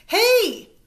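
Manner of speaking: loud high shout; yell. A narrator's reading. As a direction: shout across the sand. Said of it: "I yell to the boy".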